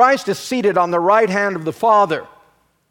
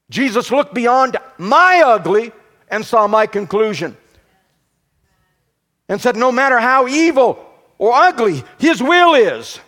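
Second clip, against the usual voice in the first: higher, louder preaching build, hammering each line; full-force preaching; 6 semitones higher, 4 dB louder than usual. Acted. Pastor driving the point home to congregation, full-force preaching. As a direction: preach it out, rising to the peak.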